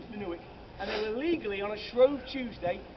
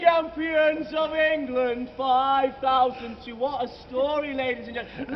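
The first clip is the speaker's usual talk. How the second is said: loud, higher, slow victory cry; triumphant proclamation; 4 semitones higher, 9 dB louder, slower than usual. Acted. Guide to group, loudly hailing the champions. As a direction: crow it like a stadium announcer. Hyped celebration of Leicester title.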